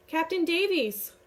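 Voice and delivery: high, loud call from afar; distant shout. A narrator's reading. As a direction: call out from the river. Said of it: "The shout wakes me".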